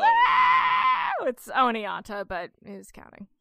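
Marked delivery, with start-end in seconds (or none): 0.0-1.1 much higher squealed mock cheer; mock crowd cheer. Fans screaming for the singer, squealed mock cheer.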